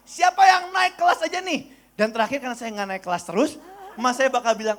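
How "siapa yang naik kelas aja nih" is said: loud, high call; grandmother's announcement. Retold or imitated. Grandma asking who passed the grade, calling loudly. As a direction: call out to the whole gathering.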